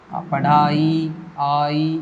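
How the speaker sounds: पढ़ाई spoken very slowly so आई separates; slowly drawn out. Shown as a demonstration. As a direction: drag out पढ़ाई to show the suffix. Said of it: "इस तरह धीरे धीरे बोल के".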